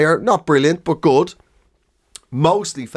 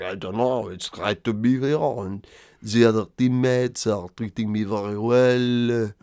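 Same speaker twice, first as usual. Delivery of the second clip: low, hesitant fake French accent; mock French accent; 4 semitones lower, 3 dB quieter than usual. Footballer at his first French press conference, mock French accent. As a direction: imitate halting English with a French accent. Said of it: "speaking like that, he's like".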